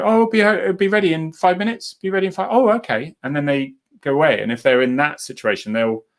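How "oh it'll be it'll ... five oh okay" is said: higher, sing-song placating voice; soothing carer. Acted. Telling Mum dinner is coming, higher soothing voice. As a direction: reassure her lightly, humouring her.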